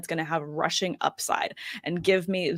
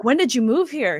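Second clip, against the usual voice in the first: higher-pitched mimicry of curious strangers; nosy strangers; 5 semitones higher, 4 dB louder than usual. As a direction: imitate someone quizzing a newcomer. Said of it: "And they're like".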